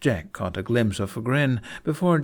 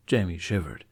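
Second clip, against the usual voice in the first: ordinary delivery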